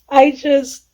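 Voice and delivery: ordinary talk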